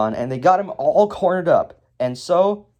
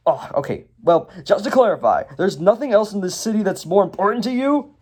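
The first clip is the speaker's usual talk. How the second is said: Lloyd; 6 semitones higher than usual. Acted. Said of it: "and then Lloyd is like".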